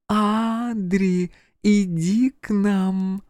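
put-on high, slow, eerie little girl; a ghost child luring him in, slowly; thin child voice. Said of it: "Тоненький знакомый голос".

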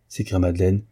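usual speaking voice

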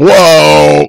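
loud, high gasp of awe; stunned whoa; amazed whoa. Retold or imitated. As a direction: blurt out wonder as the gates open. Amazed as gates swing open.

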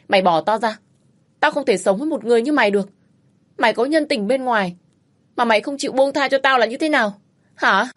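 wife yelling at husband pulling her hair; desperate angry retort. Acted. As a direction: fight back in pain and fury. Wife fights back while hair pulled.

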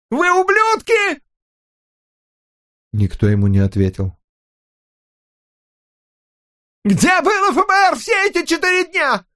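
нарастающая ярость до крика; высокий яростный крик обвинения; срывается на крик. A narrator's reading. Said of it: "к концу фразы Озмиан сорвался на крик". (нарастающий гневный крик)

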